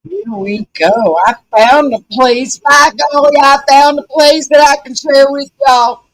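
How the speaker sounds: put-on big, folksy Southern accent with a grin. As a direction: play a loud Southern belle. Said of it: "You like that southern accent there?".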